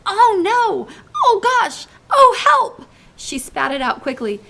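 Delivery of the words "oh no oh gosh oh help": much higher, louder panicked cries; girl crying out after a foul taste. Acted. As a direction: wail out in disgusted alarm. Sophie tasting the vegetable.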